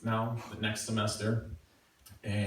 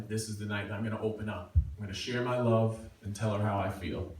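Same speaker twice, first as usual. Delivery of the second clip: ordinary talk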